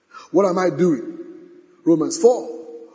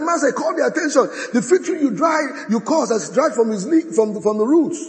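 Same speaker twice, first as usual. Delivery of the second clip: astonished disciple; 6 semitones higher than usual. Acted. Voicing Peter's astonishment at fig tree.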